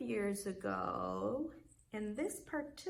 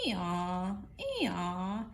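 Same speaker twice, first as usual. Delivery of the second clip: louder hee-haw of a donkey; donkey bray; 2 semitones lower, 4 dB louder than usual. A narrator's reading. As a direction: bray it like a burro. Donkey arriving, imitated bray.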